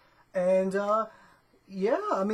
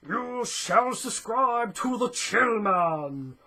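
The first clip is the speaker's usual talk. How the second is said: slow, louder wizard proclamation; Gandalf voice; 5 dB louder, much slower than usual. Pretend. Vlogger urging viewers to subscribe, slow wizard decree. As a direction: boom it out slowly like a wise old wizard. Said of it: "Kinda do feel like Gandalf right now".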